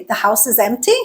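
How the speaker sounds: EMPTY lifted into a question; statement wording, rising end on EMPTY; rising intonation. Shown as a demonstration. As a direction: say it as a question with pitch climbing on EMPTY. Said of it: "by changing that intonation".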